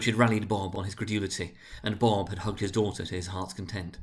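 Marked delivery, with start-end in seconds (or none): none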